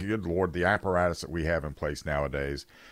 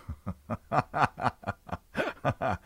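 real laugh; 3 semitones higher than usual. Real, not acted. Laughs at his own jab.

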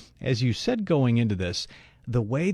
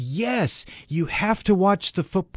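high-pitched imitation of a frantic fan; urgent, insistent reply, mildly acted out; retold frantic caller; 9 semitones higher than usual. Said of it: "He's frantic".

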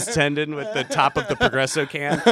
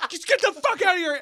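panicked threat; 13 semitones higher than usual. Retold or imitated. Acts yelling threats into the basement.

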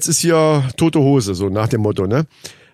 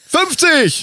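shouted catchphrase; 11 semitones higher than usual. Acted. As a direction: call it out to rouse the crowd. Catchphrase call-and-response shout.

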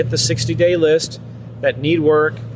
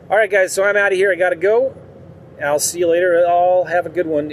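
usual speaking voice

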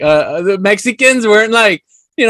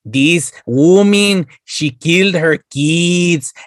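slow spooky narrator; 4 semitones lower, slower than usual. Retold or imitated. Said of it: "the voice that was telling you the story".

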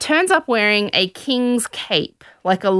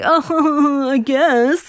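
deflated man; 7 semitones higher, much slower than usual. Retold or imitated. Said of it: "And he's like".

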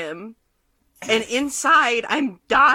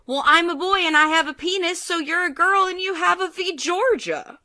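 put-on higher, quick, matter-of-fact little boy; teacher mimicking the boy, higher and quick; 10 semitones higher than usual. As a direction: play the earnest preschooler explaining. Kid explains, voiced by teacher.

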